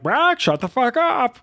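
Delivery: a parrot squawking out the neighbor's insult. Retold or imitated. Parrot mimics the neighbor, high slow squawk. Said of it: "a neighbor who's screaming through the wall".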